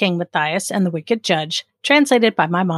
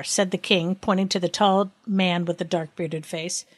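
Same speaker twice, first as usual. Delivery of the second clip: usual speaking voice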